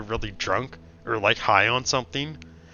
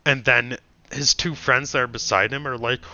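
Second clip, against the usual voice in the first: normal talking voice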